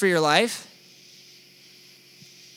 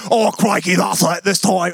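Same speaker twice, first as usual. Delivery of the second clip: Australian GPS. Pretend. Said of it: "Or maybe you have the Australian G P S".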